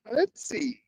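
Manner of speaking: high, soft nervous squeak; tense squeak. Real, not acted. Painter peers at the stroke, a high soft squeak. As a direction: squeak it under your breath, tense. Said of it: "Yes, I'm making noise".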